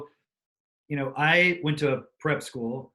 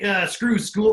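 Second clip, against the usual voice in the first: mock dismissive; 6 semitones higher, 5 dB louder, slower than usual. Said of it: "We're like".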